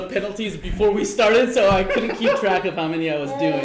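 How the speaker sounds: laughing. Real, not acted. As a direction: talk through real laughter. Laughing after the game ends.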